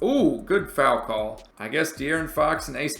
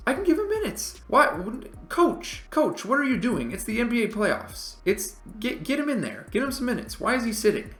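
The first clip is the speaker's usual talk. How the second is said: high, indignant rant at the coach; exasperated; 10 semitones higher than usual. Real, not acted. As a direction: plead with the coach in disbelief. Scolds the game's coach.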